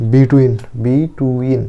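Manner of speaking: drawn out very slowly, between; slow teacher model of between; slow spelled-out between. Shown as a demonstration. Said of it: "বি এর জন্য ব".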